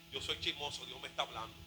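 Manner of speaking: very soft and low; quiet admission. Acted. Sensitive believer admits a fault to himself, hushed and low.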